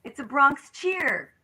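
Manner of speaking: a touch of mock astonishment; incredulous writers. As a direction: play the amazed comedy writer lightly. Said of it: "And they go".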